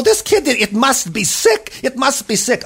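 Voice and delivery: high, worked-up worry, acted; alarmed principal. A narrator's reading. Alarmed principal diagnoses the baby.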